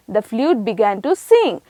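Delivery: ordinary talk, nothing marked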